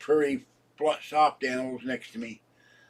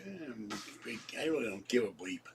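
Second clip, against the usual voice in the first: much softer, dismissive mutter; muttered shrug; 3 semitones lower, 10 dB quieter than usual. Retold or imitated. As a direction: throw it away under the breath. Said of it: "and I'm like".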